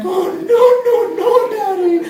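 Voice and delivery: put-on high pleading baby voice. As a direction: whine it like a clingy toddler. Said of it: "Baby's like".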